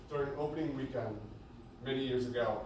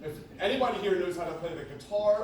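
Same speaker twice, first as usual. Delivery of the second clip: ordinary talk, nothing marked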